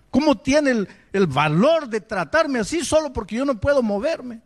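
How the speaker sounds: high, indignant protest; impassioned complaint imitating a wronged man; retold indignant outburst. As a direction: act out bitter outrage, pitch jumping up. Retold bitter outburst to God.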